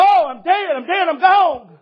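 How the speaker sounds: half-mock terror, voice shooting up; retold panic. Preacher retelling the crash to the congregation, mock panicked cry. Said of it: "I'm thinking".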